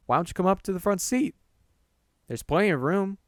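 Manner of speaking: quick, high, helpful invite; good Samaritan invitation. Acted. Narrator inviting a stranger up front, friendly and high. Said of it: "I tried to be a good Samaritan".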